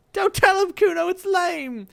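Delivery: put-on very high, loud whiny child; a tagalong kid scoffing; whiny second kid. Friend tells Kuno not to tell, high and whiny.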